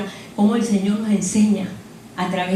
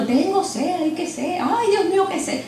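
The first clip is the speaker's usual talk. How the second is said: mock whining; 8 semitones higher than usual. Preacher mocking believers who just whine about thirst, mild mock whine. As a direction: lightly play a crybaby moaning for water. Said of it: "No me quedo llorando".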